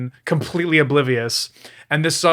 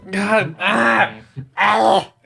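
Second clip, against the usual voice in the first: higher, cringing groans of exasperation; exasperated groaning; 6 semitones higher, much slower than usual. Real, not acted. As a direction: groan as if it hurts to listen. Cringing at the clip just played.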